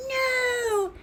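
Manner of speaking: high chorus of hens shouting; mock shout. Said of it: "shouted the other chickens".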